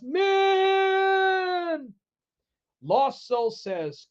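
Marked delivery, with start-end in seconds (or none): 0.0-1.9 very high, long held note; high, sustained mock-heroic cry; drawn-out call. Finishing the drawn-out slogan.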